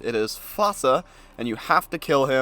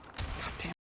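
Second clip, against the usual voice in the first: whispered curse; 17 dB quieter than usual. Real, not acted. Annoyed whispered curse mid-recording.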